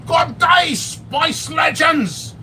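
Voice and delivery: high, drawn-out Aussie greeting; mock Australian shout of good day; Australian accent. Mocking the Australian reviewer's greeting, in an Aussie accent. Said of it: "that Australian".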